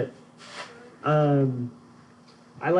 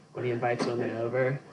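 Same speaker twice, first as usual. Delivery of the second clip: ordinary delivery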